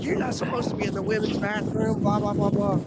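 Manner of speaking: high-pitched mocking imitation of a scolding woman. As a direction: mimic her complaint in a high voice. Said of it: "She's like".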